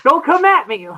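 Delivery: loud, high imitation of panic; mock frightened. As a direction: ward someone off in pretend fright. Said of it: "he was more afraid of Scoopin".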